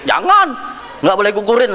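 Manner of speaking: high, emphatic cry dropping into firm refusal; retold emphatic veto; urgent forbidding. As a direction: cut in sharply high, acting out alarm. Acts out forbidding an abortion.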